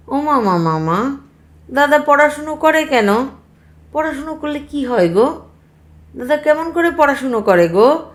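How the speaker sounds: put-on high-pitched small-boy questions; pestering little child. Little brother questioning uncle about studying, high and insistent.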